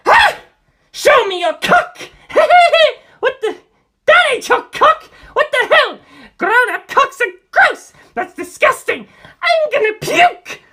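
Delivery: put-on high, loud, shrieking child's voice; a disgusted boy shrieking at the top of his voice; shrieking Antonio. A narrator's reading. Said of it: "Antonio shrieked".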